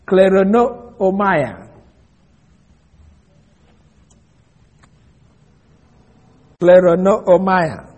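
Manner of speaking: "kleronomai" said slowly as klair-oh-NOH-my; Greek word spelled out. Shown as a demonstration. Preacher teaches the congregation the Greek word, slowly sounded out. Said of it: "the word inheritance is an interesting word".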